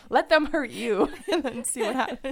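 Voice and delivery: laughing. Real, not acted. Teasing joke, laughing.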